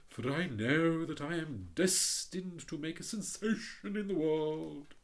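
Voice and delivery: hint of a haughty rocket; lightly voiced pompous Rocket. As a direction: read it as a vain firework showing off.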